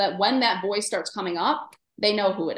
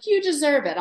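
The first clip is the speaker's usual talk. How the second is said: lightly acted inner voice, pitched higher; a slightly higher, mildly emphatic quoted voice; 6 semitones higher, 4 dB louder than usual. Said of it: "if the voice is like".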